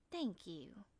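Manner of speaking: soft Southern voice; honey-smooth Southern accent. A narrator's reading. Injured woman thanks her helper, soft Southern accent. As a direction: say it softly with a Southern drawl. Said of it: "she says with a honey-smooth southern accent".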